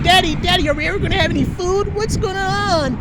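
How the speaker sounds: put-on high, pleading little child voice; a small son begging his daddy, high and pleading; child's plea. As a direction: whine like a small boy at his dad's bed. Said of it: "coming to my bed saying".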